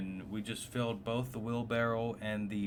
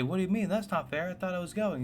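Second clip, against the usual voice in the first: higher, a bit louder kid's protest, retold; upset kid protest; 9 semitones higher, 3 dB louder, faster than usual. To dad, hearing he's left behind: higher indignant protest. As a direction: replay your teen self's outrage. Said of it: "I say".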